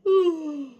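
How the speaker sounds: big tired yawning sigh; loud yawn. Real, not acted. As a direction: let out a long yawn. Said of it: "I'm yawning a lot".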